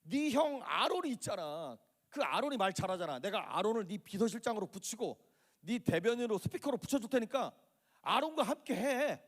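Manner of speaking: high, insistent scolding as God losing patience; exasperated anger. Acted. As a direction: scold in exasperation, stretching the key words out. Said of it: "화를 내시면서".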